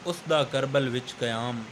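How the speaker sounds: emphatic, measured poetry recital; verse recitation. Acted. Host introducing the poet with a verse, recited emphatically. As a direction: declaim the couplet with weighted pauses.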